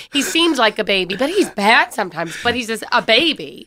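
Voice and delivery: usual speaking voice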